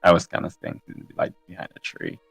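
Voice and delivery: ordinary delivery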